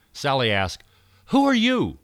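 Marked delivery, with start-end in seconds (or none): 1.3-2.0 high, loud startled question; startled shout. Acted. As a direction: cry out in sudden surprise. Sally startled by creature.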